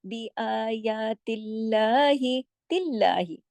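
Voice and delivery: "आयातिल्लाही" slow with a light, thin lam; recitation demo. Shown as a demonstration. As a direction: recite "तिल्लाही" thin, not heavy. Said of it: "लाम मोटा नहीं होगा".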